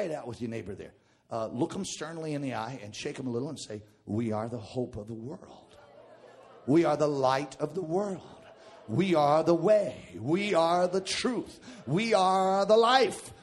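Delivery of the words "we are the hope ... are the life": preaching. Acted. Congregation chant, building preach.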